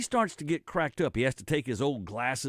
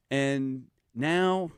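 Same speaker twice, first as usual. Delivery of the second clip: ordinary talk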